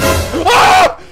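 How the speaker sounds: high shout breaking into long laughter; scream into laughter. Real, not acted. Scared while playing, shrieking then laughing.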